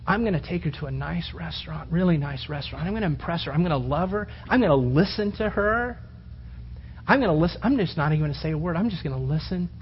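soft, low inner planning voice; nervous young suitor's thoughts. Retold or imitated. Preacher voicing a young man planning a date, soft and low. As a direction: mutter the plans to yourself. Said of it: "you thought about every single".

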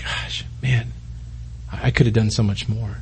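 whispered, ashamed regret; regretful whisper. Pretend. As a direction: breathe it out like a sorry confession. Regretful believer before God.

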